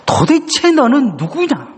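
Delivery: high, slow, stressing 도대체; God's demanding question. Retold or imitated. God pressing the congregation, high and demanding. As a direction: lean hard on 도대체. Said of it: "이렇게 물으실 것입니다".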